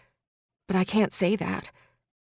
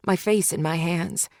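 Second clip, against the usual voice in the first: ordinary delivery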